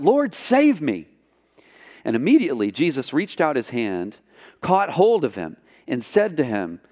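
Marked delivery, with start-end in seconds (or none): 0.0-1.1 slow, higher, understated plea; a touch of panic, drawn out; mild cry for help. A narrator's reading. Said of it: "he cried out".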